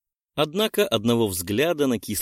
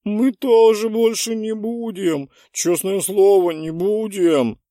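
put-on higher, louder pleading kids; pleading promise; 10 semitones higher, 7 dB louder, slower than usual. A narrator's reading. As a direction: promise earnestly. Students pleading together.

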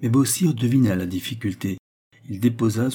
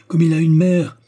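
passionate, raised voice claiming his son; passionate outcry; 6 semitones higher than usual. A narrator's reading. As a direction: declare fatherhood with fire. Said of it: "s'écria-t-il".

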